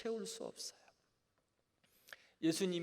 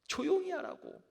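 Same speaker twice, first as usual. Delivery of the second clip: scolding shout; 14 semitones higher, 9 dB louder than usual. Acted. The crowd tells blind Bartimaeus off, shouting. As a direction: shush someone with a raised, high voice.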